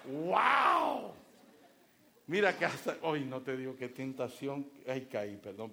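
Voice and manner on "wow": loud delighted wow; wife amazed by the dress; excited. Retold or imitated. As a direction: gasp at your own reflection. Wife admiring herself.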